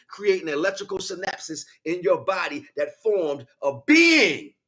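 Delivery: loud, building preacher's roar; fiery preaching. Acted. Speaker preaching to the listener about his vow, fiery preaching. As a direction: preach it at full force, driving each line home.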